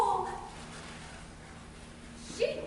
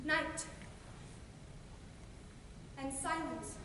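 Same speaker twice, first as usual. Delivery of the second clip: hushed and slow, barely voiced; breathy awe of the night; 2 semitones lower, 15 dB quieter, slower than usual. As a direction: creep into the stillness. Puck creeping through the forest.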